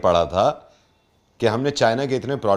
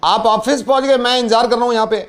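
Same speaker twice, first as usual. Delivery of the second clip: higher-pitched prank caller; pushy builder; 9 semitones higher, 3 dB louder than usual. Pretend. Prank builder says he is waiting, insistent. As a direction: play an impatient builder, voice raised high.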